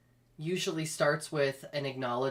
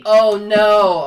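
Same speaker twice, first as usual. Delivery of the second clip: very loud, high cry of dismay; 5 semitones higher, 17 dB louder than usual. Real, not acted. Hearing about the degrading joke, a loud dismayed cry. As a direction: blurt out horror. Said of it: "reacting to degrading joke".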